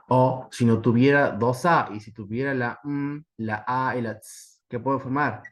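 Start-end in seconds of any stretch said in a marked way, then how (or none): 2.5-4.6 "la m, la a y la ts" with the letters given as separate sounds, m held as a hum; isolated phonemes. Shown as a demonstration. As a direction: sound out m, a, ts one by one in "la m, la a y la ts". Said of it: "Luego de escuchar sonidos aislados".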